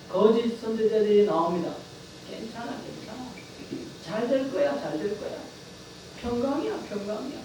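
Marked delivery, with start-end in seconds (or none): 2.3-7.4 sweet, soft, lulling mockery; soft mock-soothing false prophet voice; false reassurance. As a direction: coo empty comfort like a false prophet. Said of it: "이렇게만 말한다는 것입니다".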